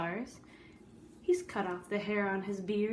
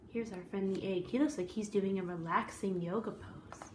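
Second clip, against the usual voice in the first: usual speaking voice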